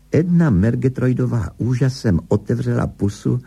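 ordinary delivery, nothing marked

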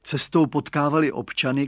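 ordinary delivery, nothing marked

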